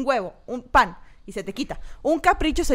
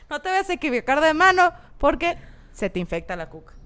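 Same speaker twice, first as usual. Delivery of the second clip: ordinary talk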